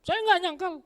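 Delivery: high, breezy brush-off; cocky disciple shrugging off the warning; confident Peter denial. Acted. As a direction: wave it away, cocky and light. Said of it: "dengan pedenya Petrus bilang".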